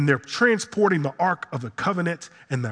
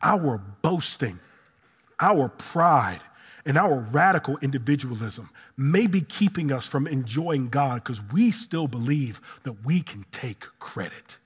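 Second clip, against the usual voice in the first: normal talking voice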